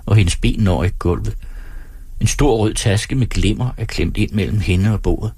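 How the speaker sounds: ordinary delivery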